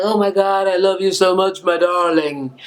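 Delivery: mock romantic hero. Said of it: "and she could be like".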